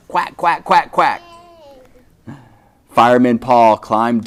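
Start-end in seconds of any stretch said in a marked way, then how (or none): 0.1-1.2 duckling quacks. Acted. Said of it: "What'd they say?".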